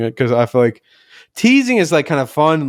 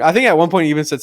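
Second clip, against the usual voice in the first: normal talking voice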